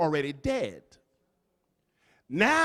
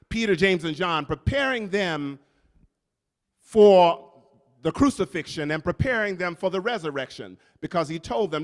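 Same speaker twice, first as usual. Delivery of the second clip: ordinary talk, nothing marked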